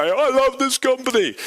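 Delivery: high, loud hammed-up rally cry; mock a frenzied CEO; over-the-top CEO hype. Imitating a hyped CEO on stage, high and loud. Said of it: "that Steve Ballmer guy and say".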